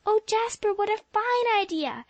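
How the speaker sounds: light up with a sudden great idea; Polly thrilled by Jasper's plan, exclaiming; enthusiastic exclamation. A narrator's reading. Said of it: "exclaimed Polly, all her enthusiasm returning".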